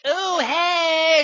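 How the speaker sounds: high loud ghostly greeting; startling hey. Pretend. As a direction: pop out with a cheery hey. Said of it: "and you're like".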